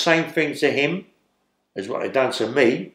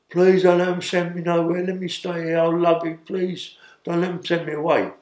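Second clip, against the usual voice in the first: higher, begging voice of a drugged man; imitated pleading; 6 semitones higher than usual. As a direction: beg your friend not to let them send you away. George begging Ray on mattress.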